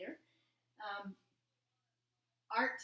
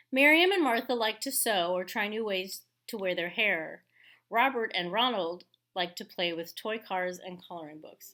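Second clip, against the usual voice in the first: louder, measured read-aloud voice; story narration; 9 dB louder than usual. A narrator's reading. Teacher reading a picture book to her class, storytelling voice.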